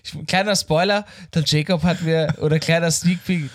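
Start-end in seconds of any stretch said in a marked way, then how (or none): none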